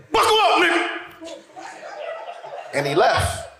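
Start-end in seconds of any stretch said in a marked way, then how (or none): 0.1-0.9 very high, sudden holler; catchphrase shout. Acted. Cousin quitting the job, high-pitched shout. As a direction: fire it off way up high.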